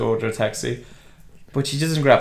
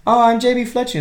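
high, louder put-on lady voice; J B Fletcher; 11 semitones higher, 5 dB louder than usual. She introduces herself to the vet, high lady voice. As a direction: introduce yourself in a hammed-up high voice.

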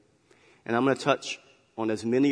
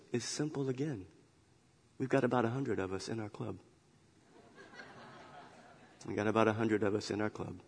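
soft, understated cub voice; hushed punchline as the little cub; quiet punchline; 2 semitones lower, 10 dB quieter than usual. Acted. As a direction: deliver it softly, deadpan.

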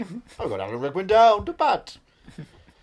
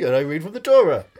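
usual speaking voice

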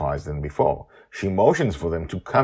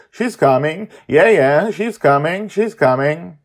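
high, loud cheering chant; dance and cheer; celebrating girl; 11 semitones higher, 9 dB louder than usual. Acted.

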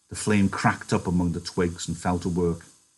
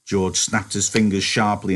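ordinary delivery

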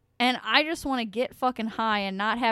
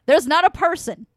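louder emphatic; 5 semitones higher, 8 dB louder than usual. Real, not acted. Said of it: "repeated for emphasis".

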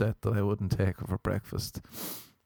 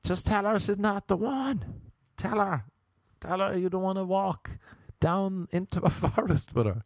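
mock screaming brain; 10 semitones higher than usual. Brain nagging him in bed, high mock-screaming plea. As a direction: urge in a strained, pitched-up voice. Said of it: "your brain is screaming".

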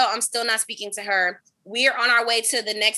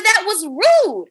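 mock scolding; 8 semitones higher, 5 dB louder, slower than usual. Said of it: "I said".

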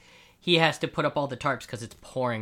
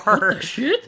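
shocked; 7 semitones higher, 8 dB louder than usual. Real, not acted. Reacting to the poster.

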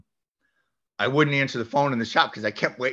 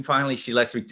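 ordinary talk, nothing marked

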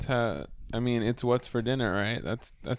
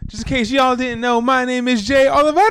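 hyped intro; 12 semitones higher, 11 dB louder than usual. Acted. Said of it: "excitement wasn't there".

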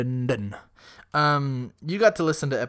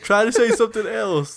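laughing; 9 semitones higher, 8 dB louder than usual. Real, not acted. Laughing at Gollum attempt.

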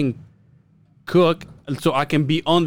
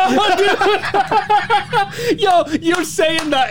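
real laughter, higher and slow; cracking up mid sentence; words pushed out through laughter; laughing; 12 semitones higher, much slower than usual. Real, not acted. Cracking up at partner's joke.

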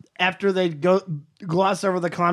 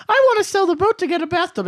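high whiny voice; 10 semitones higher than usual. Retold or imitated. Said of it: "She says".

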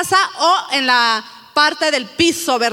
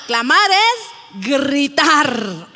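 emphatic definition; 3 semitones lower, much slower than usual. Real, not acted. Key definition stressed.

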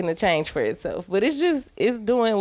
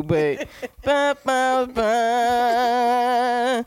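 sung "ba ba ba" of disappointment; sad trombone; much slower than usual. Shown as a demonstration. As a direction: hum a letdown sound effect. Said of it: "sound effects of disappointment".

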